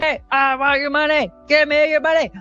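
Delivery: a touch of a greedy mugger; hammed-up give me your money; mock money demand.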